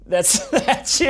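laughing while teasing. Real, not acted. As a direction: laugh through the words.

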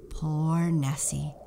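ordinary delivery, nothing marked

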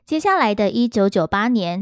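ordinary talk

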